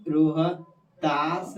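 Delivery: careful, slow map-pointing naming; slow drawn-out naming. Shown as a demonstration. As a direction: say Rohtas very slowly and stretched. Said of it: "ठीक है, ध्यान से देखिए".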